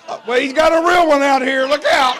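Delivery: high and loud, alarmed; excited warning. Retold or imitated. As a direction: blurt it out like a startled onlooker. Wife and daughter peeking warning.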